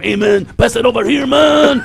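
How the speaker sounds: a lightly put-on stoner voice, rising on the last "man". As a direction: do a hazy stoner buddy. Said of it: "the next [name] and [name] going".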